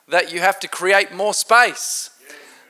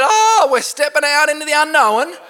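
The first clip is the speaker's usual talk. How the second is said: higher, nervous mock worry; mock trepidation; 9 semitones higher, 3 dB louder than usual. Preacher imitating a leader's nervous doubt, voice up high. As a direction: play the anxious leader stepping into the unknown. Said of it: "bit trepidation".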